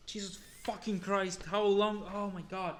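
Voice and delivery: loud, fed-up cry at the list; exasperated outburst. Real, not acted. Reader fed up with the long list, exasperated shout. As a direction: vent frustration at the endless words. Frustrated at endless word list.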